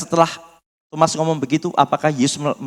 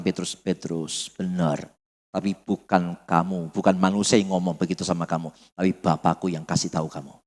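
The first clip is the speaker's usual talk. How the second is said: gentle Jesus; 7 semitones lower, 6 dB quieter than usual. Retold or imitated. Jesus voiced gently in retelling.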